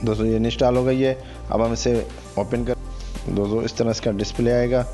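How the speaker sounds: ordinary talk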